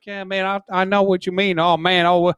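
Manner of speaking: mock good-ol'-boy Southern accent. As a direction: slip into an imitation Southern twang. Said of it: "from the South, I'm like".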